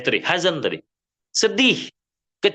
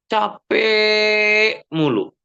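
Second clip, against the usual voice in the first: drawn-out, very slow and higher, like someone worn out; weary groan; 7 semitones higher, much slower than usual. Acted. Preacher mimics people always worn out, slow weary moan. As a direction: stretch the words out as if exhausted.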